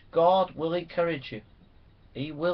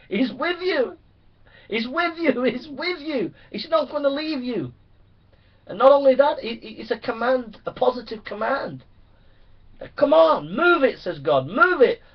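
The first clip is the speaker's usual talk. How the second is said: much higher, louder, fervent; ecstatic preaching; 11 semitones higher, 8 dB louder than usual. Acted. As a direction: soar up into full revival cry. Preaching at full force.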